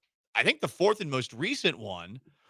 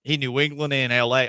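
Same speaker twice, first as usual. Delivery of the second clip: louder, lightly put-on twangy coach voice; a hint of a good-old-boy coach voice; put-on coach accent; 6 dB louder than usual. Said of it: "I gave him this accent".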